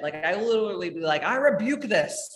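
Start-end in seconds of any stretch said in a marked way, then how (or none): 1.2-2.3 slow, higher, hammed-up rebuke; crazed rebuke. Woman refusing to let negative talk in, mock-fierce rebuke. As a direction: cast it out slowly and dramatically. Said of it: "crazy around that shit".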